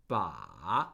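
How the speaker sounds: Ba dipping very low, then rising; third tone on Ba. Shown as a demonstration. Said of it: "The falling and rising tone".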